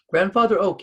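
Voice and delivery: calling out. A narrator's reading. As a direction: call out to Grandfather Oak. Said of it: "he called".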